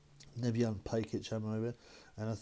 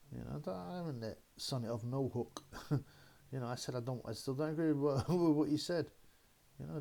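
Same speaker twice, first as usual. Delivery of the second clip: usual speaking voice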